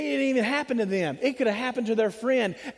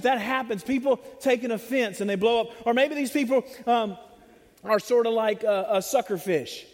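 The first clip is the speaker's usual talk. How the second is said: usual speaking voice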